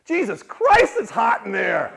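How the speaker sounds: high, loud yelled outburst; kid's yell. Retold or imitated. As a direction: blurt it out like a kid popping from an egg. Brother's outburst in pageant.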